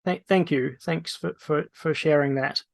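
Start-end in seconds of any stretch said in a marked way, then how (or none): none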